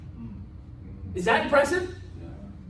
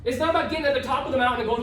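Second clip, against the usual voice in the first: normal talking voice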